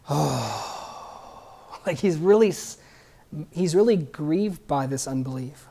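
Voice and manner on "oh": long, low, breathy sigh of grief; deep sigh. Retold or imitated. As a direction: imitate a deep, heavy sigh from within. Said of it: "or he sighs, like".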